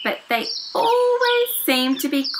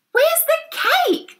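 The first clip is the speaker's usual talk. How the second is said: very high, squeaky little creature voices demanding. Acted. Tiny creatures demanding cake from Sebastian. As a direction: squeak out the demand, much higher. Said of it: "they demanded".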